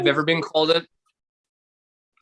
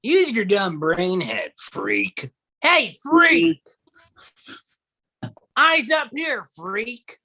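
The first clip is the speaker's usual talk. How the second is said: mock bully taunt; 8 semitones higher, much slower than usual. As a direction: sneer it like a schoolyard bully.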